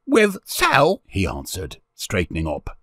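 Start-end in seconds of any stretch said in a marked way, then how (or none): 0.0-1.0 put-on high, loud child voice; boy gaining courage. A narrator's reading. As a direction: answer up like a plucky street boy. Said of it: "gave him courage".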